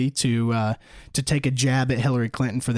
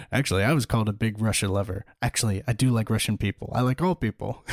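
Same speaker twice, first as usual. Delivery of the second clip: mock Trump voice; 7 semitones lower, faster than usual. Host mocks Trump rereading his own tweet, lightly voiced Trump. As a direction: toss it off like a smug Trump.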